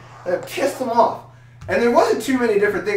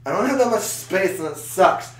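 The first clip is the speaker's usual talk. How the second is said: ordinary talk, nothing marked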